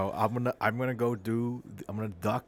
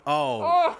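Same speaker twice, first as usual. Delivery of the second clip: shocked gasp; 9 dB louder than usual. Acted. Reacting to bucket hit.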